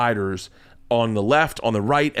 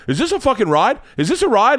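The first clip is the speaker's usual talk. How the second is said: high-pitched mock dad protest; dad's loud secret; 8 semitones higher than usual. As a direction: imitate a dad who cannot keep it discreet. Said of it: "no ability to talk in, like, a secret".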